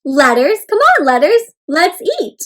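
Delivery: playful teacher calling the letters to eat. Shown as a demonstration. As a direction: call out to the letters to come eat. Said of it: "So it's like".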